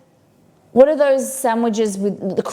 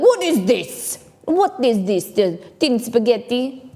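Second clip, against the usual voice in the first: hammed-up Italian accent, pitched higher; terrible Italian accent; 4 semitones higher, slower than usual. Imagining Italian parents baffled by tinned spaghetti, bad Italian accent. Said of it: "That's a terrible Italian accent".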